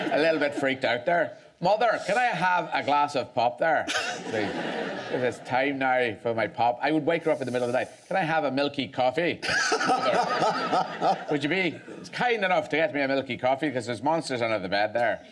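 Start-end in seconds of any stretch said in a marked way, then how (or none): 1.6-6.8 put-on child's Northern Irish accent. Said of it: "freaked out by the sound". 8.1-15.2 put-on child's Northern Irish accent. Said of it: "freaked out by the sound".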